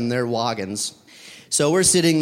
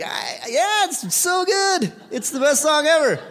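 squeaky-high mock enthusiasm; gushing agreement; 13 semitones higher, slower than usual. Stressed traveller agreeing about the song, hammed-up enthusiasm. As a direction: gush in an exaggerated, very high voice.

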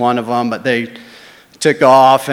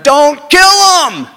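much higher, loud, slow drawn-out yell; 9 dB louder, much slower than usual. Retold or imitated. Buddy below yelling up at the big guy, slow high shout. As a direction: scream it up from below, stretching each word. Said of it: "the guy's buddy down below is yelling".

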